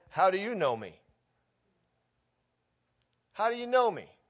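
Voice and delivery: high, loud, fervent scripture; preacher thundering John chapter one; preaching at full force. Acted. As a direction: proclaim it to the room.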